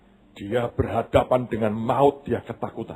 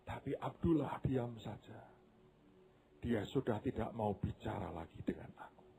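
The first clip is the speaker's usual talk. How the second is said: much softer, fading to a whisper; tearful; 16 dB quieter than usual. Acted. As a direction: whisper it through tears. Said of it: "sambil menangis dia berkata".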